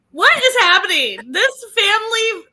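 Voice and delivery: loud, high disbelief; incredulous. Real, not acted. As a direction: react in shocked disbelief. Reacting to cringe video.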